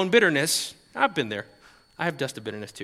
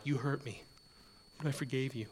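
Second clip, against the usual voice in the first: passive-aggressive; 4 semitones lower, 13 dB quieter than usual. Retold or imitated. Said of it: "passive-aggressive".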